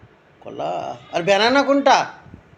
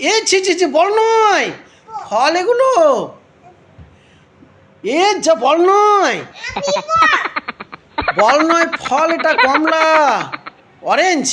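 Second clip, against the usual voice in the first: exclaimed correction; 6 semitones higher, slower than usual. Real, not acted. Correcting child who calls fruit a ball.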